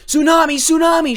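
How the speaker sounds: high, full-throated alarm shout; frantic warning yell. A narrator's reading. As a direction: warn the whole village at full voice. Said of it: "shouted Yoshi".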